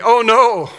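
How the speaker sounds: loud, high cry of protest; as if the Lord is asking and he brushes it off; eager protest. Retold or imitated. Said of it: "my response would be".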